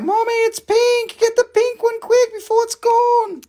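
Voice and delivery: put-on high, squealing child voice; four-year-old daughter begging mom to grab the pink one; excited little girl. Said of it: "and she's like".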